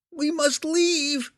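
very high, slow creature voice; clear-voiced monster. A narrator's reading. Hook Horror urging Belwar to leave, high slow creature voice. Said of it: "the clarity of his voice".

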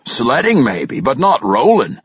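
ordinary delivery

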